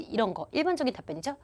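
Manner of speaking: usual speaking voice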